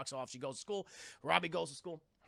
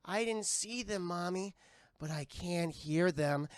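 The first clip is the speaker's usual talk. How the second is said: put-on slow, eerie little girl; creepy child voice; slower than usual. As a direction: say it like a haunted kid. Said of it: "She goes".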